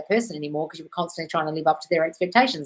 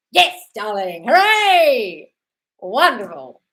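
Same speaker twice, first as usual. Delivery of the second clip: high, loud, sing-song hooray; cheer; 4 semitones higher, 8 dB louder, much slower than usual. Real, not acted. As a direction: celebrate the viewer with a big cheer. Cheering a viewer's progress.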